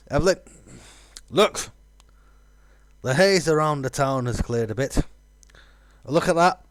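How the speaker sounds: high, loud, eager character read; excited game hero. Acted. Hero points out the town to Evelyn, excited call.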